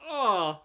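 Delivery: loud, drawn-out "ugh"; exasperated groan. Acted. Streamer reacting to a mispronounced name, loud exasperated groan. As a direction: groan loudly in disgust. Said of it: "and I'm like".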